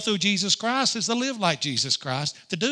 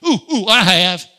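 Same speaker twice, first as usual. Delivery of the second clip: loud, hooting eager volunteer; mock-eager outburst, much louder; eager hand-raiser; 10 dB louder than usual. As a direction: shout it like a kid begging to be picked. Acts eager hand-raiser answering his question.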